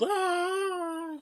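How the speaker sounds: high fake yelp of pain; flop yelp. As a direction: yelp like a player flopping. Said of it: "and goes".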